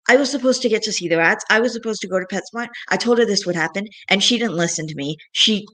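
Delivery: normal talking voice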